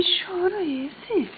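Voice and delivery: soft whispered "Que choro é esse?"; hushed whispered question. Real, not acted. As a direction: whisper the question tenderly to the pet. Repeats it whispered.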